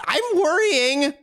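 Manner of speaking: whiny drawn-out fret; slow, high mock worry.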